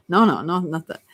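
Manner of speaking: ordinary talk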